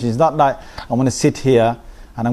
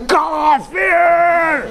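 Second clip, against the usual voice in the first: high, long-held mock battle cry; imitating an overheated preacher's shout; hyped-up shout. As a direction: stretch the word out in a rabble-rousing yell. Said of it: "hyped up and get you hyped up and say".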